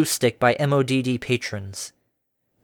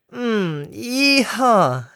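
mock yee-haw; 9 semitones higher, much slower than usual. A narrator's reading. Mock cowboy cheer after dousing.

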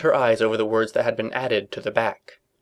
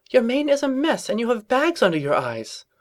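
Rarity voice; 12 semitones higher than usual. A narrator's reading.

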